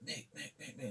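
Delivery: whispered, rapid hushed calling; urgent whisper. Retold or imitated. Bar crowd whispering a warning to Mick. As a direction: hiss his name under the breath. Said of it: "they're like".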